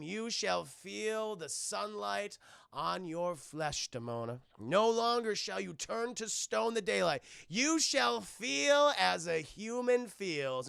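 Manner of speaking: slow, grand incantation; hammed-up magical decree; spell-casting. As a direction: intone it slowly like a booming sorcerer. Puck casting his spell.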